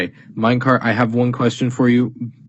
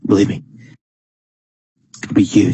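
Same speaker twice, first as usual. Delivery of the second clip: Donald Trump impression; faster than usual. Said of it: "I'm gonna be Donald Trump".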